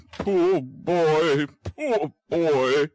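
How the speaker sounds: put-on old gentleman moved near tears; moved old man. Brownlow pities the sick boy, mildly choked. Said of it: "clearing his throat".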